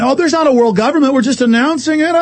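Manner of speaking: high, quick mock-official voice; mocking denial. Host imitating government denying world rule, mockingly.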